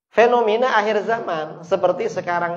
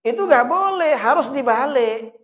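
much higher, louder firm ban; forceful preaching; 10 semitones higher, 6 dB louder than usual. Real, not acted. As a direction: hammer the rule home from the pulpit. Preacher forbids it with full force. (larangan keras dengan suara lantang dan tinggi)

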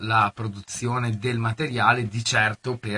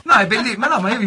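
laughing; 10 semitones higher, 7 dB louder than usual. Real, not acted. Laughs with both guests.

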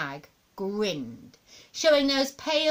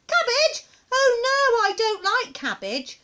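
put-on high, loud child's protest; high-pitched boyish horror; dismayed little boy; 12 semitones higher, 6 dB louder than usual. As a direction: wail about hated food. Boy appalled at eating cabbage.

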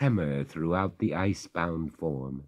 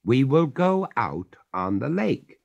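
firmly; 7 semitones higher, 5 dB louder than usual. A narrator's reading. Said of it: "said the master firmly".

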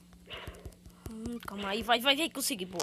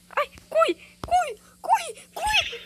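high, shrieking cries to run; panicked running; 5 dB louder, much slower than usual. Real, not acted. Gamer panicking as an enemy chases, shrieking. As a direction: shriek at them to run for their lives. Fleeing danger in the game.

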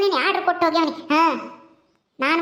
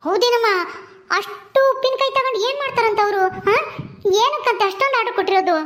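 high-pitched, amazed questions; astonished questioning; 4 semitones higher than usual. Acted. Mother hearing of a huge pickle order, astonished. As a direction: ask in raised-pitch disbelief.

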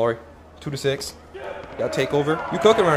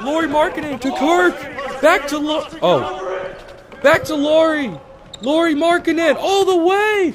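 excited hype; 11 semitones higher, slower than usual. Real, not acted. Excited play-by-play of own team.

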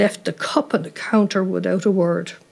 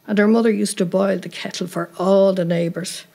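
usual speaking voice

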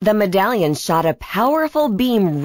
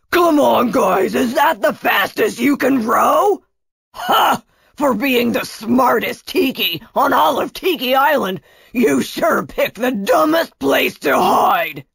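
higher, shouty villain voice; bossy villain, taunting; 5 semitones higher than usual. Acted. Kunatos to his rowers and Tia, bossy gloating. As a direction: harangue the rowers, then jeer at your prey.

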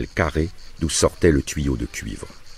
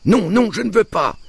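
put-on very high squealing little boy; howled protest; 4 dB louder than usual. A narrator's reading. Little Paul refusing on the road, howling. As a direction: howl it as a small child refusing. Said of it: "Paul hurla".

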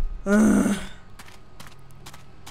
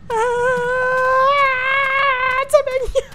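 1 whimpering; 12 semitones higher than usual. Real, not acted. Frightened whimpering at game.

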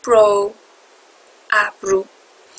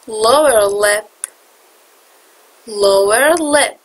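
slow, careful Lower lip; 5 dB louder, much slower than usual. Shown as a demonstration. Teacher slowing Lower lip down so students can copy it. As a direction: Lower lip stretched out slowly, syllable by syllable. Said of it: "slowed repeat for students".

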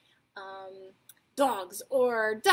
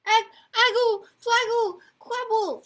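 put-on loud, high toddler gibberish; toddler babble; 10 semitones higher, 8 dB louder than usual. A narrator's reading. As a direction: babble urgently at the top of your voice. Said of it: "said Trixie again".

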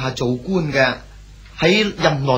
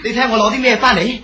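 laughing, eager; 7 semitones higher, 4 dB louder, faster than usual. Acted. Son proudly shows lychees to father.

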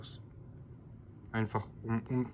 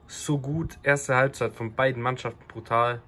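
excited; 3 semitones higher than usual. Real, not acted. Thrilled after great match.

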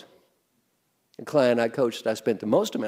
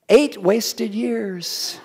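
mock lament; 7 semitones higher, 4 dB louder, much slower than usual. Mock-mourning an audience answer.

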